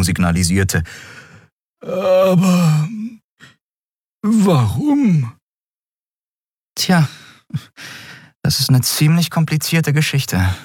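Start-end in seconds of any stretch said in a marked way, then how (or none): 1.8-10.5 high, bright creature voice; a small winged creature prying gently; curious Xea. A narrator's reading.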